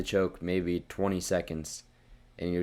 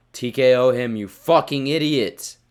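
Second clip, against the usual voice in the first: shouting in real frustration, louder and higher; angry shout; 6 semitones higher, 10 dB louder than usual. Real, not acted. Ranting at the fighter for shooting a takedown, furious shout. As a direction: yell it at the screen like he's blown the round.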